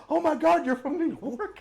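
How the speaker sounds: high, loud fake surprise; mocking parking attendant. Parking guy sneering at New Yorkers, high mock shock. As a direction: gasp it out in mock disbelief. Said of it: "He's like".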